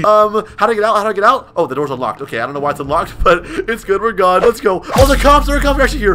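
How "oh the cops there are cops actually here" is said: high, loud cry of alarm. Real, not acted. Fleeing burglar spots the cops arriving, alarmed. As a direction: shout out the sudden danger. Police arrive during in-game getaway.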